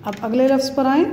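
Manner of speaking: normal talking voice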